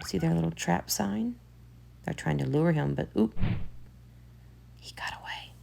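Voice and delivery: quiet, hushed aside; hushed voice tracking the chase; hushed commentary. Real, not acted. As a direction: murmur as if not to disturb. Quiet commentary over the video.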